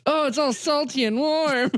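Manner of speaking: high mock movie voice. Friend reenacting a gross movie line, high and goofy. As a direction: squeal it like a cartoonish movie character. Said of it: "It's like".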